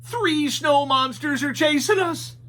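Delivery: high goofy Shaggy voice. A narrator's reading. Shaggy asking Scooby about a noise, high and goofy. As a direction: ask like a nervous cartoon slacker.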